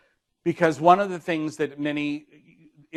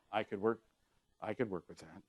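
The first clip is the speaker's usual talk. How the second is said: much lower and softer, sincere-sounding; 5 semitones lower, 11 dB quieter, faster than usual. Shown as a demonstration. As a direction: sink the voice to sound convinced. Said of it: "you say".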